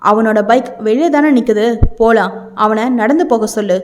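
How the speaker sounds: plain reading voice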